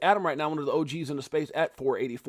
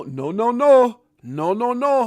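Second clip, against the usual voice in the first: lightly mimicked finger-wag "no, no, no", higher; Mutombo commercial; 6 semitones higher, 7 dB louder, much slower than usual. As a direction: tease like the old Mutombo ad. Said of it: "Remember that commercial?".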